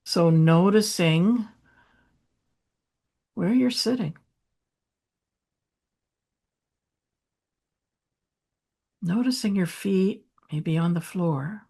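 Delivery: unhurried, softening meditation voice with long pauses; gentle grounding guidance, hushed by the end; guided meditation. Real, not acted.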